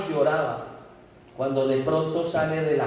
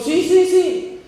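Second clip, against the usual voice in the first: overwrought yes; 12 semitones higher, 6 dB louder than usual. Acted. Boy confesses, overwhelmed.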